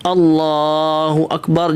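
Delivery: اللہ اکبر said very slowly and low, each sound stretched; drawn-out takbir. Shown as a demonstration. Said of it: "جب تکبیر تحریمہ کو شروع کریں". (اللہ اکبر آہستہ کھینچ کر)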